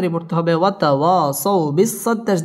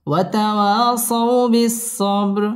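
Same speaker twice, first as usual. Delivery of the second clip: slow, drawn-out melodic recitation; Quran recitation; 6 semitones higher, much slower than usual. Shown as a demonstration. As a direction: chant it slowly, tajweed style. (ধীর সুরেলা কুরআন তিলাওয়াত)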